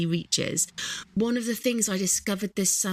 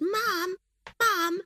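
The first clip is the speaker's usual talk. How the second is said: put-on much higher, whiny child's call of Mum; nagging kids; 13 semitones higher, much slower than usual. Tired mum imitating her kids nagging all summer, whiny child voice. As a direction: pester like a small child wanting a snack. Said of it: "Just that constant".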